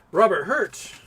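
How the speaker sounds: high, punchy exclamation; excited call-out. Real, not acted. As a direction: announce it with a jump up. Exclaims the big donor's name.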